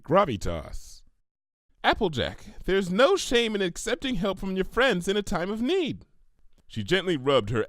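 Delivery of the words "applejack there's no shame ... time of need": high pony voice, calm and earnest; gentle Pinky voice. Acted. Pinky gently telling Applejack to accept help. Pinkie turns serious.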